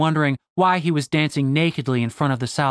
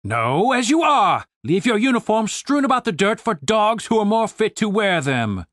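indignant, pompous military officer; commander's ringing scolding; stern commander; 6 semitones higher than usual. Acted. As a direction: reprimand the men with grand outrage.

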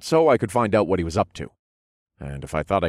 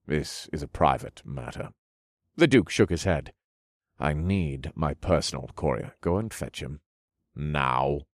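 low, soft, cold commands; stern nobleman; 5 semitones lower, 4 dB quieter than usual. A narrator's reading. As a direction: quietly insist on obedience. Duke refuses servant.

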